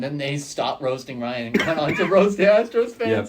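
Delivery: real laughter bubbling into the words; amused laughing. Real, not acted. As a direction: laugh through the telling. Laughing while telling funny story.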